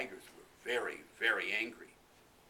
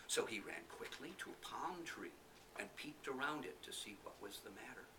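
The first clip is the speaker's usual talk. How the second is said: ordinary delivery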